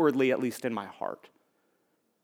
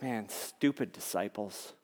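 mock scorn; 5 semitones lower, 13 dB quieter than usual. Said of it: "we're like".

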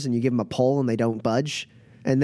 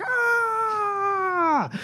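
crowd cheer. Acted. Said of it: "everyone's like".

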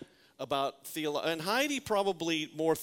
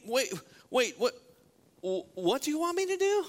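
high, alarmed wait-what; a frightened follower spluttering; panicked disbelief; 9 semitones higher than usual. Acted. Ananias panicking at God's order.

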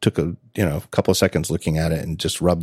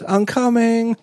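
son calling down; slower than usual. Retold or imitated. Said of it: "And Jonas goes".

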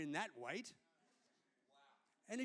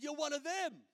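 higher, louder mock yell of an upset stranger; retold yell; 7 semitones higher, 5 dB louder than usual. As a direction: imitate her yelling at him, raised and pitched up. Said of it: "She yelled at me".

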